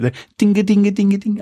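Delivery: high, light sing-song ding-a-ding; sung tinkle. Retold or imitated. Host imitating the new theme tune's tinkly bit, sing-song. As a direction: mimic a tinkly jingle with your voice.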